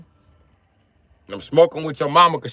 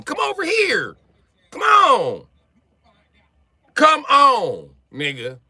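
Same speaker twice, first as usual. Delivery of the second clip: taunting call; 6 semitones higher, slower than usual. Acted. Taunting outsiders to come visit.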